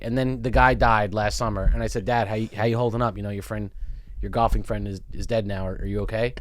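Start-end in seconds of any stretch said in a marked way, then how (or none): 2.0-6.3 low, soft careful check-in; gentle concern. Retold or imitated. Son checking on dad after his friend's death, gently concerned. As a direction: ask gingerly, voice dropped.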